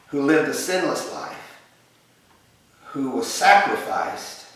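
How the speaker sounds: ordinary talk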